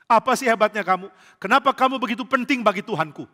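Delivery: incredulous angel. Acted. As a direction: demand to know why, astonished. Malaikat heran. (malaikat heran, suara naik)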